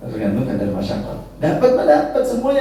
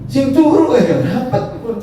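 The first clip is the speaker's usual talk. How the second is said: much higher, louder, laughing; laughing joke; 12 semitones higher, 4 dB louder than usual. Real, not acted. Preacher teasing sleeping listeners, high laughing joke. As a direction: tease the dozers through laughter. Joking about sleeping congregants.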